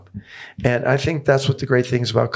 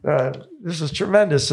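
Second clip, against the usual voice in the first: lightly put-on Australian accent, higher; mock Aussie accent; 4 semitones higher than usual. Aussie fan greeting the player at a bar, mock Australian accent. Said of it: "they s-, so".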